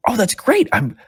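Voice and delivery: high, bright eager voice; eager young man. Acted. Gentleman greeting the newcomers, high and eager. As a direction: gush like an overeager newbie. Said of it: "the gentleman's like".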